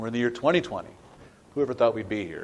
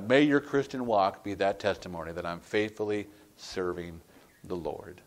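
ordinary talk, nothing marked

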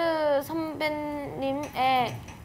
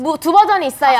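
home shopping host; 9 dB louder than usual. Pretend. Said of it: "홈쇼핑처럼 막 해줘야 돼요".

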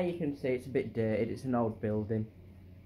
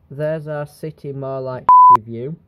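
much louder, proudly announcing the nickname; playful loud proclamation; loud call-out; 15 dB louder than usual. Real, not acted. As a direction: boom out the joke name for the view. Calling out their joke nickname.

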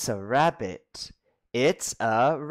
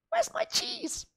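very high, soft squeaky mouse; little mouse voice; 4 dB quieter than usual. Acted. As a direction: ask like a tiny hungry mouse.

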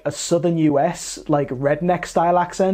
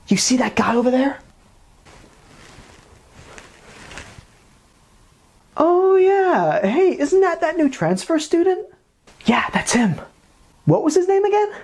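higher, excited dubbed schoolgirl; anime dub voice; 9 semitones higher than usual. Acted. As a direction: gossip about the new kid.

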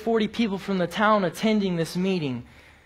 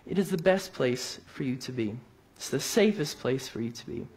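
soft, lowered sermon close; reassuring; 4 semitones lower, 6 dB quieter than usual. Real, not acted. Preacher assuring friends of the safest place, softly reassuring. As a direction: drop down gently to land the assurance.

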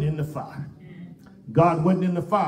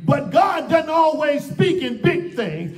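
raised voice building on each repeated phrase; emphatic pulpit cadence; impassioned preaching; 6 semitones higher, 3 dB louder than usual. Real, not acted. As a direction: press the congregation hard. Preaches at full force.

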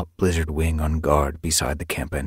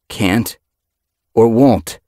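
rising menace, louder at the end; dangerous; 6 semitones higher, 9 dB louder, much slower than usual. Acted. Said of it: "voice grew dangerous".

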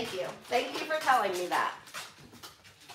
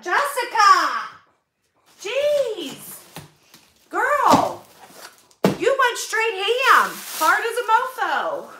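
higher, louder delighted hollering of her name; excited calling out; 7 semitones higher, 5 dB louder than usual. Real, not acted. Praising a donor for a huge box, excited hollering. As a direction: hype up the donor like a friend.

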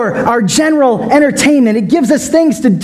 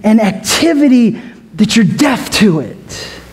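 ordinary delivery

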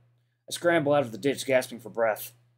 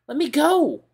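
much higher and louder, crying out; desperate shout; 9 dB louder, slower than usual. Acted. TJ shouts at Chase to let go, desperate and loud. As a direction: yell to break free. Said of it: "still whispering, but it gets getting louder".

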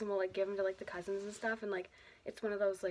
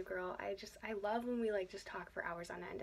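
usual speaking voice

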